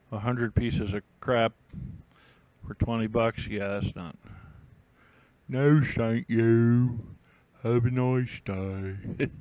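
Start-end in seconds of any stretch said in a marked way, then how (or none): 5.5-9.3 slow, squeaky piggy voice; a cartoon piglet politely turning down a seller, slow; little piggy voice. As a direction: draw it out like a cute little pig. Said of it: "It's my little piggy voice".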